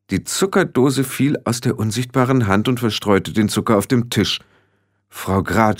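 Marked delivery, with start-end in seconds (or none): none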